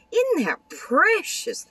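very high, slow, gushing squeal; Isn't that precious squealed very high and slow; delighted squeal. Real, not acted. Gushing over card.

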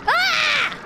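high-pitched short shriek; real yelp of alarm; startled scream. Real, not acted. Surprised by ghost in game.

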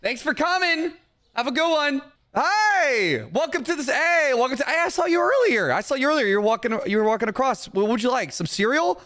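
high-pitched, over-friendly clerk patter; eager shopkeeper. Pretend. Shopkeeper hailing passing customers, eager high voice.

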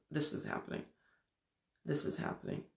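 breathy unvoiced whisper, each snapshot hushed. Speaker mimicking the mind's present moments, whispering. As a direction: breathe it out like a secret.

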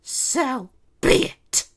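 slow, high, loud retort; sneering. A narrator's reading. Princess Kitty back on her feet, sneering. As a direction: sneer it out word by word. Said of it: "she cracked her neck and sneered".

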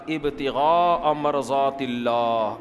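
Arabic phrase drawn out very slowly; slow, reverent Quranic intoning; slow recitation. Shown as a demonstration. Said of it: "اللہ کہتے ہیں".

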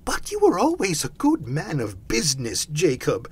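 high, anxious protest; faltering. A narrator's reading. Scrooge excusing his old partner, faltering. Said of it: "faltered Scrooge".

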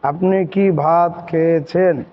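soft, feeble voice of a sick person. Shown as a demonstration. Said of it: "কথা বলতে কেমন একটু নরম হয়ে যায় না?".

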